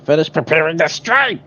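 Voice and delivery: loud, high cartoon villain voice; mustache twirling villain. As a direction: bark the order like a stage villain. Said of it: "if I use my villain voice enough".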